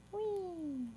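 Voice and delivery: high gleeful whee; playful squeal. Real, not acted. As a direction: squeal with glee as it sizzles. Pouring pork into the pan.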